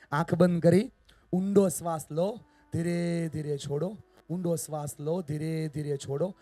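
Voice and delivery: slow, stretched, projected instructions; guided breathing. Real, not acted. Speaker guides the audience through breathing, slow guided-meditation delivery. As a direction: draw out each breathing cue.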